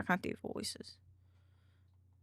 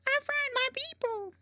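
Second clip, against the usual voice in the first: much higher squeaky shrimp voice. Acted. Shrimp character answering the question, high and squeaky. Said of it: "doing the shrimp one".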